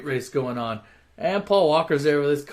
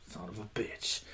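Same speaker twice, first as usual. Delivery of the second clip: low grumble; 6 semitones lower, 15 dB quieter than usual. Retold or imitated. Jealous character grumbles at him.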